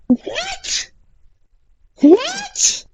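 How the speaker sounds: very high, disbelieving what; stunned squeak. Real, not acted. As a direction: squeak it out in shock. Shocked at game.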